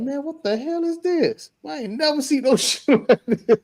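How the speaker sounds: high, stunned what-is-this; shocked disbelief. Retold or imitated. New member seeing the ceremony for the first time, high stunned disbelief. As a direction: react like you can't believe your eyes. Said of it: "I'm like".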